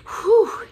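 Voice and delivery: high, loud sigh of relief; relieved whew. A narrator's reading. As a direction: blow out a big relieved whew. Relief after surviving night.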